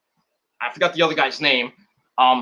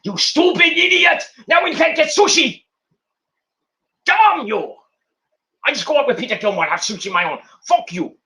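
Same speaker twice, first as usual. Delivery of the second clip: a hammed-up furious villainess; mock angry rant; 9 semitones higher than usual. Voicing Mei Ying's angry rant.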